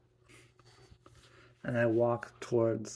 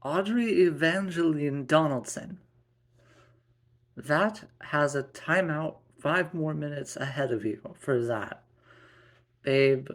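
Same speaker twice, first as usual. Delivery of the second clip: raised, higher scolding voice; stern mother; 4 semitones higher, 4 dB louder than usual. Acted. Mother giving her daughter a timeout, stern. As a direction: lay down the law to a child. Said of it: "Quinn had a stern voice and said".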